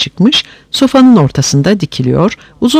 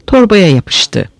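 ordinary delivery

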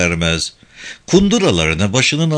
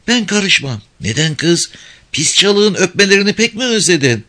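put-on high, lively little village girl; kız çocuğu sesi; 7 semitones higher than usual. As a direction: play a cheeky young girl. Village girls bickering. (tiz sesli köylü kız)

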